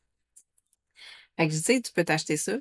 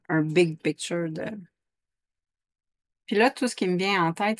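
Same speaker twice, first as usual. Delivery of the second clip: ordinary talk, nothing marked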